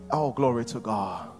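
ordinary talk, nothing marked

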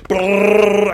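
a trilled brrrr like a pounding heart; imitated heartbeat. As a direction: roll the lips to mimic a racing heartbeat.

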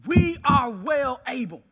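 slow, loud preacher's proclamation; emphatic declaration. Acted. Caleb rallying the people against the giants, emphatic declaration. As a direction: proclaim it slowly and forcefully.

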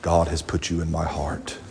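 low, hushed mock-solemn declaration; creepy. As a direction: lean in low and soft, overly earnest. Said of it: "this gets creepy".

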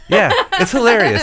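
loud, high mock agreement; exasperated sarcasm. Retelling his fed-up reply to his wife, sarcastic.

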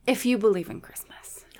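"if you believe in Christmas" whispered with no voice. Real, not acted. Cohost explaining the movie rule in a whisper. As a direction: drop to a hushed whisper. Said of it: "whisper reply".

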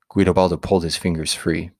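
ordinary delivery

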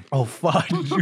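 ordinary delivery

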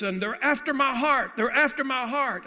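loud, high-pitched outburst; demon's agitated shout. Retold or imitated. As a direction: cry it out at full force, high and insistent. Boy's demons cry out in story.

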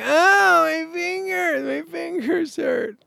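high-pitched whiny wimp voice; hammed-up sore-fingered wimp; mock wimp. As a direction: whimper it in a tiny high voice, mocking yourself. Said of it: "But I was a wimp".

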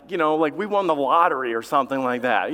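ordinary talk

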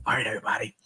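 whispered to the room. Retold or imitated. Speaker quietly opens his talk to the inmates, whispering. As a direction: lean in and whisper it. Hushed start to prison speech.

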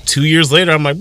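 usual speaking voice

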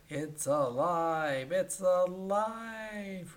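very slow, drawn-out mock mad-scientist cry; Frankenstein cry. Burns sees his robot move, slow drawn-out mock cry.

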